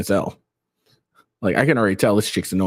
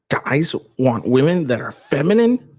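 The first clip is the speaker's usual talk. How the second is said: higher, sarcastic fake astonishment; mock disbelief; 6 semitones higher than usual. To viewers, mocking the idea with fake astonishment. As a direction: act stunned at the obvious, teasingly.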